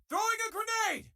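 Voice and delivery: high, urgent battle shout; combat callout. Acted. Crew member calling out a grenade throw, urgent shout.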